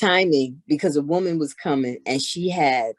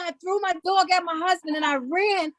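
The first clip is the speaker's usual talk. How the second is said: excited high voice; 3 dB quieter than usual. Retold or imitated. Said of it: "She said".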